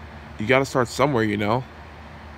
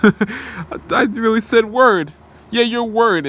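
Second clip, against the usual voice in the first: high, slow giggling over his slip; laughing at himself; 10 semitones higher, 6 dB louder, much slower than usual. Real, not acted. Villain laughs at his own word slip, giggling high. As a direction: laugh through the line at the blunder.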